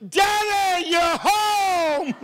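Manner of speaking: high, drawn-out squealed greeting; mock delighted daughters.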